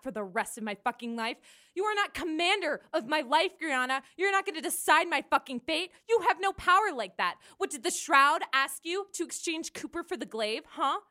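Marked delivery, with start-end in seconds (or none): 1.7-11.0 higher and louder; angry retort. Acted. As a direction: fire it right back. Kai fights back.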